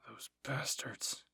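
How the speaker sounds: slow, drawn-out curse; understated bitter swearing; cursed. Acted. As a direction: curse them slowly under restraint. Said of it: "he cursed".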